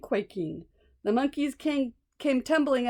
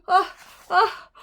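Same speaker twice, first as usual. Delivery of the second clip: high gasping build to a sneeze; pre-sneeze gasps; 5 semitones higher, 4 dB louder than usual. Acted. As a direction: catch the breath before a sneeze. Elephant about to sneeze.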